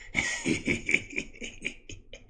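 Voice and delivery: long hissy sinister giggle; evil wheezy doctor snicker; creepy snicker. Acted. Creepy doctor finds a vein.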